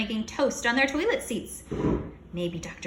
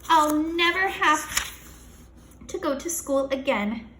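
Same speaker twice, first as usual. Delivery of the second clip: gleeful; 6 semitones higher, 4 dB louder, slower than usual. Acted. Kid gloating over riches.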